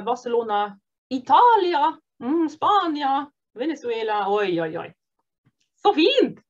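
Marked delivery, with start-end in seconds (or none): none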